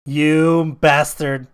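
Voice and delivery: loud, slowly drawn-out playful curse; mock outrage. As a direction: stretch it out in pretend indignation. Mock rebuke of co-host's teasing.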